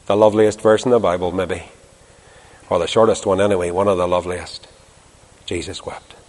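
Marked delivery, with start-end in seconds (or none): 5.5-6.2 hushed and tender. Real, not acted. Preacher savours the shortest verse.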